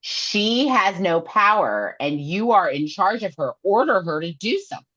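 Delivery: mock demand. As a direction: demand it, hammed-up. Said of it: "Nesta's like".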